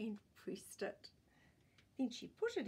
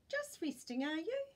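higher, brighter cheerful assistant; cheery; 8 semitones higher, 5 dB louder than usual. Acted. Shop assistant cheerily greeting tired grandpa, higher pitch. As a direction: greet him kindly with a lift. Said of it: "she asked cheerily".

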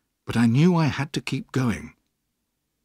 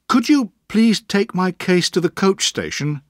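put-on polite young David; 7 semitones higher, 4 dB louder than usual. A narrator's reading. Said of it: "I asked him politely".

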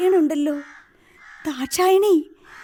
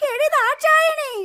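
calling out; 7 semitones higher than usual. Acted. Old woman calling the maid.

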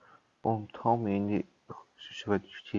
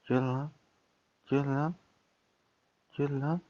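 calling out; 6 semitones higher than usual. Real, not acted. Calling a character over.

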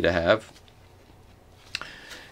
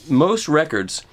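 ordinary talk

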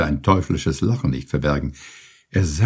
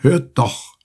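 calling out; 6 semitones higher than usual. A narrator's reading. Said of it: "rief Penelon".

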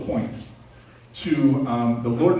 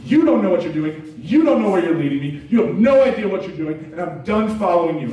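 raised, angry rant at God; louder angry outburst; 6 semitones higher, 5 dB louder than usual. Retold or imitated. Man yells at God on the road, loud and angry. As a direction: get in his face and shout it out. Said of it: "I get in his face".

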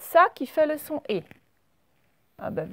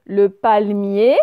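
Le palmier drawn out and louder; slow louder Le palmier, stressing the final ier; 8 dB louder, much slower than usual. Shown as a demonstration. Teacher reading examples aloud to pupils, slow and loud. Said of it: "à la fin".

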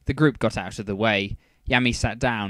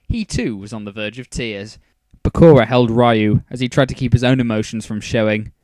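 usual speaking voice

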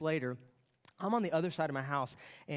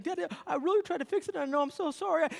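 put-on high, louder tearful boy's apology; crying child; 13 semitones higher, 6 dB louder than usual. Son sobbing apology to dad, high child voice. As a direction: beg forgiveness like a small kid. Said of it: "he's just crying".

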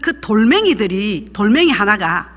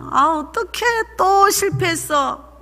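mock dejected whine; 6 semitones higher, slower than usual. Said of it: "코가 쭉 빠져가".

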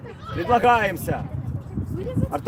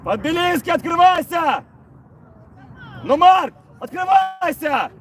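yelling from the sidelines; 5 semitones higher than usual. Real, not acted. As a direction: bellow the instruction from the bench. Coach yelling at players. (тренер кричит игрокам с бровки)

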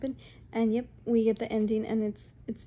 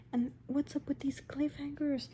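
raised-pitch whispered gripe; 4 semitones higher, 4 dB quieter than usual. Real, not acted. Vlogger ranting about cliffhangers, whispering. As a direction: hiss the question in disbelief.

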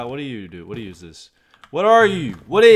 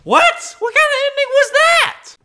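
much higher, squeaky incredulous question; baffled disbelief; 4 dB louder than usual. Real, not acted. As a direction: react in total disbelief at the ending. Game ends abruptly.

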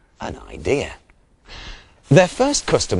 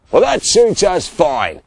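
louder, higher yelled retort; 7 semitones higher, 5 dB louder than usual. A narrator's reading. Shearers yelling back at Sean, yelled. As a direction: yell it back at them. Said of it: "yelled the other shearers".

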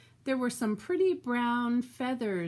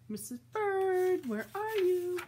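calling out; 8 semitones higher than usual. Acted. Mister Bird calls out searching for his wife. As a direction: call out for Missus Bird across the yard.

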